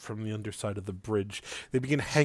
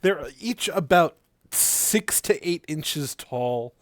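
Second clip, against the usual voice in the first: usual speaking voice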